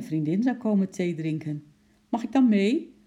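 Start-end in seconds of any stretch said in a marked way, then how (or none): 2.1-2.9 put-on high, loud eager child's question; quick eager request. A narrator's reading. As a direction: jump in, asking keenly. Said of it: "vroeg Lotje snel".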